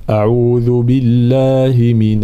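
slow chanted seeking refuge; تلاوة خاشعة; Quran recitation. Acted. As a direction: recite the istiadha slowly and solemnly. Begins recitation.

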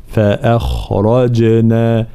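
فأخرجنا with a light hamza, heavy خ and ر, and a bouncing ج; slow recitation of فأخرجنا; tajwid demonstration. Shown as a demonstration. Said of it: "هكذا".

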